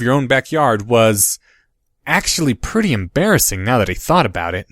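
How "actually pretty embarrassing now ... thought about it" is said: ordinary delivery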